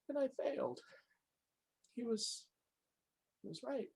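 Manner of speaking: high, hushed, halting confession; small, pained voice after an outburst; broken admission. Acted. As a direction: admit defeat softly, with pauses.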